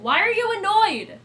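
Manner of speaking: high, loud, hurt protest to the cat; mock offended plea. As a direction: whine at the cat, wounded. Pleading with the cat.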